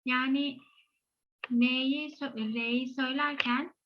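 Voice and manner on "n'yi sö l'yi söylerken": ordinary delivery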